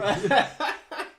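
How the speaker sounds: loud high burst of laughter. Real, not acted. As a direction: crack up at your own impression. Bursts out laughing after the quote.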